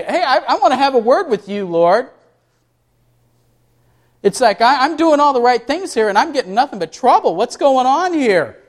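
complaining Job. Retold or imitated. Job taking God to court, lightly voiced exasperation. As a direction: plead your case like a wronged man. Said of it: "He wanted to take God to court and say".

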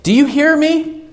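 pitched high, crying out "hear"; desperate plea. Retold or imitated. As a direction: reach up to God, begging to be heard. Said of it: "and said".

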